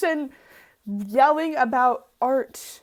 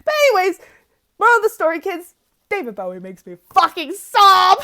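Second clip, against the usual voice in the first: loud, higher, gushing declaration; excited shout; 8 semitones higher, 12 dB louder than usual. Real, not acted. Telling viewers [name] makes her sob, loud excited shout.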